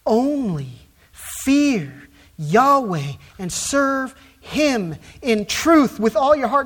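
slow, loud, drawn-out preaching plea; preacher's slow, loud resolve; resolved pleading preaching. Shown as a demonstration. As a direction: plead with them to serve Yahweh alone, slow and firm. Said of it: "you can hear the resolve, you can hear the pleading, you can hear the preaching".

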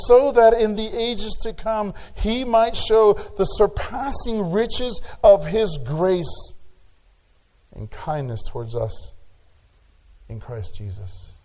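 a pastor urging the church to use their gifts, preaching hard; full-force preaching. Acted. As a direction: preach it out to the congregation. Reads scripture, then preaches on grace.

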